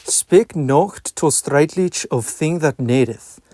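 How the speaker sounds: reading in reconstructed Middle English pronunciation; old vowels, 'nations' as nasjɔnz, 'out' as ut. Shown as a demonstration. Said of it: "I shall now begin reading Dialogue Between a Lord and a Clerk".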